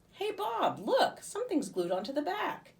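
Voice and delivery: high bright discovery call; thrilled to show him; excited Livy. A narrator's reading. Livy finding the feather, excited and high.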